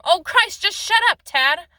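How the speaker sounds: exasperated shouting, high and loud; angry yell. A narrator's reading. Mother yells at her crying son to stop, exasperated. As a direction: yell it, completely fed up. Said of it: "she yelled at him".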